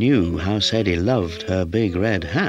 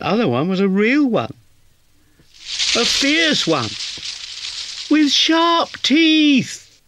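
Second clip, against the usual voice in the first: building very high and louder, ending very slow; insistent; 4 dB louder, slower than usual. A narrator's reading. Kitten telling Towser the real lion was fierce, insisting. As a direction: kitten growing more urgent.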